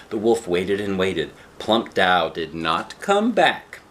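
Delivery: ordinary delivery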